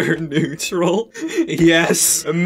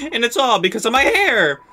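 ordinary delivery

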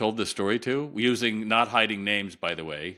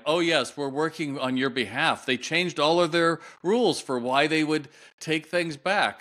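mock cheery shopkeeper; 7 semitones higher than usual. Retailer's family member brushing him off.

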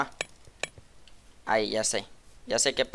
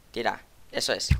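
normal talking voice

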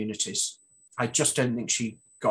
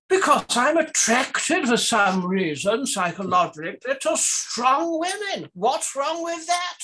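put-on voice; 10 semitones higher, 4 dB louder, slower than usual.

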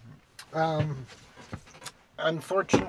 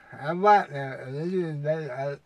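holding tongue; 6 dB louder, much slower than usual. Retold or imitated. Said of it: "that'd be neat if the president of the United States did that".

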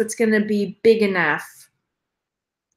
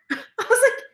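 laughing; 13 semitones higher, much faster than usual. Real, not acted. Laughing at mom's reaction.

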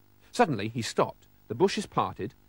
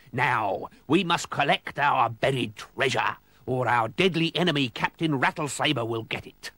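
pirate captain. A narrator's reading. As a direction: lay out the plan as pirate skipper. Pirate captain gives orders to crew.

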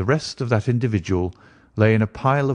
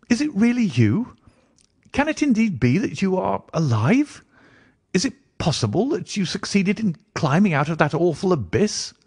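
much higher, incredulous questioning; astonished cry; 10 semitones higher than usual. A narrator's reading. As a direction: pitch it right up in amazed disbelief. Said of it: "I cried".

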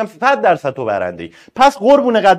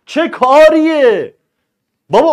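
higher, louder, very slow "چه کاریه"; exasperated outburst; 6 semitones higher, 4 dB louder, slower than usual. Real, not acted. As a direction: drag out "چه کاریه" in disbelief. Exasperated rhetorical question.

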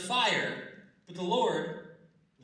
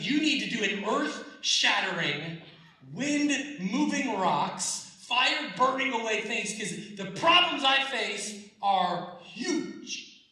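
louder, higher and slow, hammed-up pleading; mock desperate plea; 5 semitones higher, 6 dB louder, slower than usual. Preacher mimics congregants begging God, hammed-up loud plea. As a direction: ham up a frantic demand to God. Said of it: "building mock desperate prayer".

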